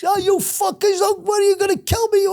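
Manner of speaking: mock high-pitched shout of outrage; outraged protest. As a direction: act out a trapped man yelling at his rescuers. Trapped man protesting, acted in story.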